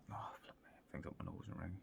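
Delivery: real whisper to himself, breaking off; whispered aside. Real, not acted. Interrupting his fight recap with a whispered aside. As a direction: drop to a whisper for a quick aside.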